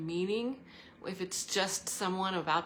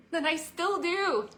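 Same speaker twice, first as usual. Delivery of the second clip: laughing; 10 semitones higher, 4 dB louder than usual. Real, not acted. Laughing about teacher's quote.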